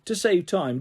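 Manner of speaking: ordinary delivery, nothing marked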